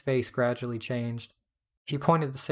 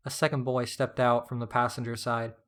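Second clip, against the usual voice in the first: ordinary delivery, nothing marked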